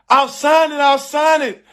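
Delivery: high-pitched mock wailing plea; imitated crying pleading. Bride in her wedding dress giving in, mock-crying plea. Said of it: "she hard down crying".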